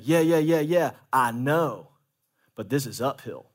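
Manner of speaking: normal talking voice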